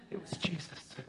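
breathless whisper of recognition; awed whisper. Acted. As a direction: whisper it as if you can't believe it. Sudden recognition of Jesus.

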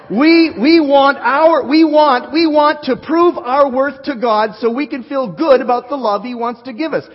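preacher raising his voice to the crowd; emphatic preaching. Real, not acted. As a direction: hammer the point home to the congregation. Preacher builds to emphatic point.

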